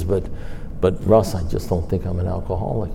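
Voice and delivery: imitated whisper. Sponsee confiding to his sponsor, softly imitated hush. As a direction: drop to a near whisper like a secret. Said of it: "he whispered".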